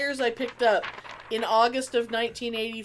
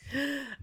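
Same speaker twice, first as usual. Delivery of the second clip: 1 gasp; 4 semitones higher, 10 dB quieter than usual. Pretend. Mock surprise at "it's us.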